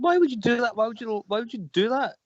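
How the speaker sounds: high-pitched, exasperated questioning; acting out baffled outrage at a date; retold indignant protest. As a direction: demand an explanation, voice jumping up. Retells confronting date over sugar.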